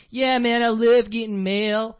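put-on Philly accent. Comic imitating a woman from Philly, hammed-up Philadelphia accent. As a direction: stretch "mail" in a mock Philadelphia accent. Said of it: "Philly's fucking awful".

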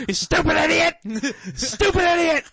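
high, loud laughing insult; Jericho impression. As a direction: yell it with a laugh. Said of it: "I love when he says that".

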